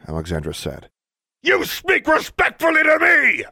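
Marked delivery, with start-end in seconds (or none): 1.4-3.4 much higher, louder furious shout; bellowing. Acted. Inspector demands respect from the schoolgirl, furious shout. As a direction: shout her down in rage. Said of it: "Mister Brown bellowed".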